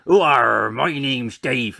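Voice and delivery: loud, slow stage pirate. Retold or imitated. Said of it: "I had to do the pirate thing".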